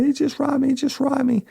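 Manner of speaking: very high, mock tearful wail; imitating crying victim. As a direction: squeak it out like the shaken clerk. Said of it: "comes out and she's crying".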